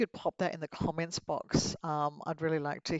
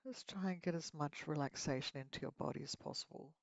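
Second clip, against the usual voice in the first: guided meditation; 6 dB quieter than usual. Acted. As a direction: lead them gently into relaxation.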